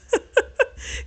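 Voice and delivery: high, airy giggle; real laugh. Real, not acted. Reviewer amused she had to apologise, high laugh. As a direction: laugh at her comeuppance.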